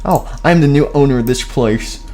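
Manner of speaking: usual speaking voice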